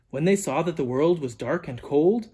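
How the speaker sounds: ordinary delivery, nothing marked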